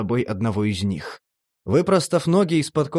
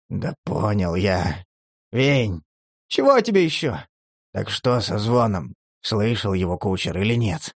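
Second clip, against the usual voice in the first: Demid's weary voice; 5 semitones lower than usual. A narrator's reading. Demid gives in and asks about the ringing, lower. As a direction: concede then press the question.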